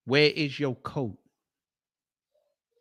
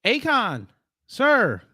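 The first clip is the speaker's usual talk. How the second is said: high, loud call across the room; hey, come over here; 6 semitones higher, 3 dB louder than usual. Real, not acted. Calling the lookalike over, high and loud.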